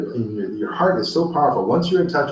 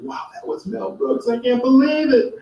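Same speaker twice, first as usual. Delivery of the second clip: much higher, breathless amazement; awestruck thrill; 14 semitones higher than usual. Retold or imitated. Walking back to his car after meeting Mel Brooks, thrilled and amazed. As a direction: gasp it out like you can't believe your luck.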